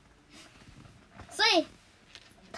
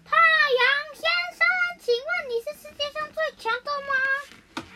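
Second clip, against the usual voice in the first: calling out; 10 semitones higher, 5 dB louder than usual. Acted. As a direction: call up to someone far away in the sky. Mouse chief calls up to sun.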